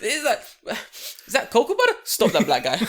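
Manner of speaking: very high, loud mock-shocked busybody; mock suspicious neighbour. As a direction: squeal like a scandalised neighbour. Mocking neighbour suspicious of him.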